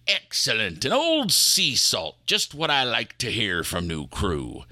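put-on approving pirate accent, lightly done. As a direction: praise the old sea salt in a pirate accent. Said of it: "that's an odd accent".

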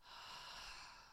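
long breathy release sigh; dramatic sigh. Shown as a demonstration. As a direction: let out a big audible sigh. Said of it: "And just give a big sigh".

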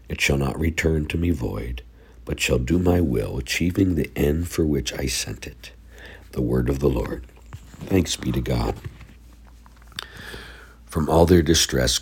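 normal talking voice

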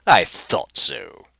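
loud mocking "Ha!", then a slow gloating sneer. Villain Rapaju mocking the pair, loud and slow. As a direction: crow over catching them out. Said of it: "grinning mockingly at the pair".

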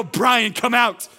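high, loud summons; forceful shout to raise the dead; commanding voice. Acted. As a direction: call a name out with full authority. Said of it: "commanding voice of the authority".

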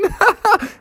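short, high jeering laugh; mocking laugh. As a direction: cap the taunt with a giggle. Other kids mock the bears.

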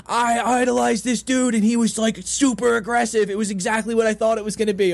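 high-pitched retold fan excitement; excited. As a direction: gush like a thrilled fan retelling it. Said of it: "he was so excited".

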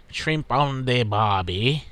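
slow, hammed-up Australian accent; mock Australian accent. Host greeting a listener in Australia, mock Australian accent. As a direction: stretch it out in a joke Aussie accent. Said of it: "Excuse my offensive accent".

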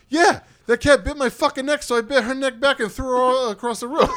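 put-on loud, high drunken rant; hammed-up drunken shouting, laughter creeping in; imitating drunken outrage. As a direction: mimic a drunk guy indignantly yelling. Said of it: "the way he said it all drunken".

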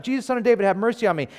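high-pitched cry for mercy; retold shout. Beggar shouting to Jesus, retold shout. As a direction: call out, pitch lifted. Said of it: "he began to shout".

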